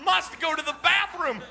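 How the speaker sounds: put-on high, loud frantic kid voice; a stalling son begging for the bathroom; urgent child. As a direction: plead like a boy who must run. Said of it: "you're not gonna have water".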